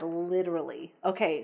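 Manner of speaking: ordinary delivery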